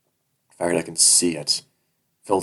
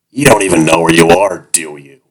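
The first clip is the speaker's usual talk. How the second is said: leering captor; 15 dB louder than usual. Acted. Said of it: "he echoes my thoughts".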